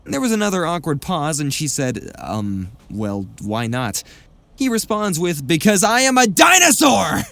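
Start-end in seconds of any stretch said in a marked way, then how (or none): 2.2-4.0 low, slow, puzzled question; lower, halting voice, unsure what to say; hesitant customer. A narrator's reading. 5.5-7.2 loud, high, theatrical shout; hammed-up high-pitched yell; dinosaur outburst. A narrator's reading. Coworker's sudden outburst in story.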